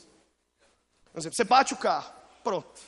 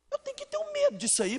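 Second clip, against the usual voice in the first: very high and soft; preacher imitating a worried believer; mock worry; 5 dB quieter than usual. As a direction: squeak it out like a fretful listener. Mock anxious listener.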